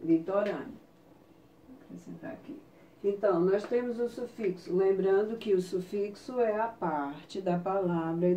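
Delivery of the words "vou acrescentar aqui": whispered to herself while writing; whispered aside. Real, not acted. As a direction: drop to a whisper, just a note to self. (sussurrando para si mesma)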